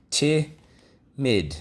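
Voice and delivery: Timid said clearly for students to repeat; teacher model word. Shown as a demonstration. Teacher modelling Timid for the class to echo. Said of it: "Let's go".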